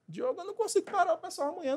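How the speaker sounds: high-pitched protesting manager; hammed-up objection, fast and way up high; Franklin. Manager telling Diogo he can't spare staff, high mock protest. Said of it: "é o Franklin da vida dizendo".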